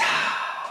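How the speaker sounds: quiet unvoiced sigh, barely there; soft mock sigh. Streamer hams up patience, breathing out a soft sigh. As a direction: let out a tiny resigned sigh.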